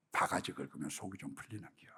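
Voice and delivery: breathy whispered repeat of 바가지 긁으면, rising at the end; mocking whisper to himself; whispered echo. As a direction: echo her words in a whisper, half amused. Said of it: "whispered echo of quote".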